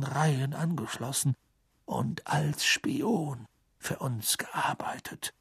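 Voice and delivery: put-on hushed, calm old voice; quiet, measured testimony; whispered old wizard. Dumbledore testifies calmly. (ruhiger alter Zauberer flüsternd)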